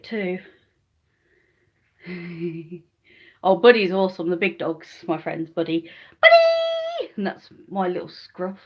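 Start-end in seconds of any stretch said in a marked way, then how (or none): 6.2-7.1 very high, loud call of the name; calling the dog. Real, not acted. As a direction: shout for the dog across the house.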